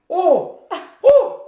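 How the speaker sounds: sharp, high, loud pained "oh"; pained cry. Real, not acted. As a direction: cry out as the heat hits. Reacting to hot sauce burn.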